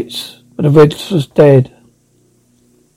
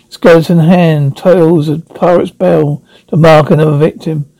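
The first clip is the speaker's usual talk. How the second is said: usual speaking voice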